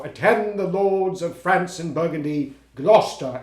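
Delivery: mock Lear declamation. Actor showing his friend how he'll open as Lear, lightly hammed. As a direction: toss off a quick sample of the grand line. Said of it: "I'm gonna go".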